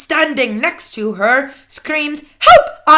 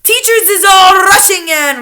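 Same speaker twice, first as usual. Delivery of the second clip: louder, higher eager telling; raised witch voice; 5 semitones higher, 6 dB louder than usual. A narrator's reading. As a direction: push the words out loudly. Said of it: "shouted the Grand High Witch".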